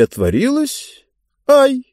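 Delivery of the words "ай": sudden high, louder yelp; startled cry. A narrator's reading. Girl startled as the door swings open, sharp yelp. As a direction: jump at the opening door. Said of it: "сказала Диба".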